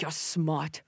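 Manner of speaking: soft, lightly imitated Korean accent; Doctor Na's Korean accent. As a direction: praise softly with a slight Korean accent. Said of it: "I'm imitating her".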